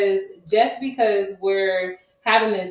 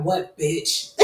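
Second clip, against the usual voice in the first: brother's voice; 3 semitones lower than usual. Retold or imitated. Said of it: "he be like".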